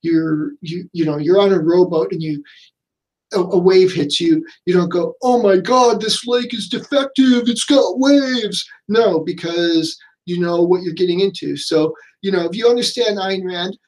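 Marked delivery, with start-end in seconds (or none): 5.2-8.6 raised, indignant mock complaint, slowing on the last words; a put-on huffy tourist whining about waves; mock outrage. Mock complaint about a wavy lake.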